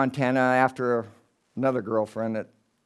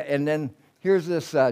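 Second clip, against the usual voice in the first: normal talking voice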